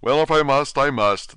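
plain reading voice

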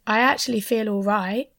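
usual speaking voice

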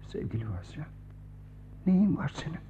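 soft, unvoiced, caring; tender whisper. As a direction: whisper his name tenderly. Tender concern for crying friend. (şefkatli fısıltı)

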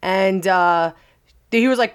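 ordinary talk, nothing marked

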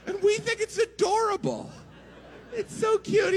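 mock cooing. Sarcastic standup about pirate kids.

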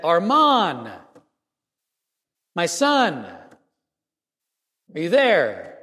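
father calling down into the rubble for his son; calling into the hole. Retold or imitated. Father calls into rubble for his son, mild call. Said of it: "he yelled inside this".

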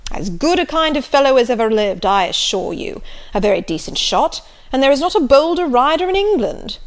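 loud, bluff country squire; jovial loud old gentleman; hearty Sir John. Acted. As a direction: boom it out like a jolly squire.

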